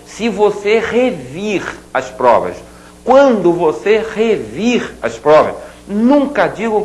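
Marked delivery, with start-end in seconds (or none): 0.1-5.5 "revir" said slowly and clearly; slow correct demo. Shown as a demonstration. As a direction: model the right form, slowly. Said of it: "o é vai ter que passar para i". ("revir" devagar e claro)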